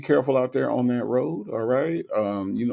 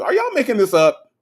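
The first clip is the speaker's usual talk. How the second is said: amazed; 11 semitones higher, 5 dB louder than usual. Real, not acted. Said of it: "disbelief at the chat's menu".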